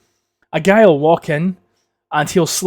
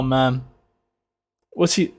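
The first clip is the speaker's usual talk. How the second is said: ordinary delivery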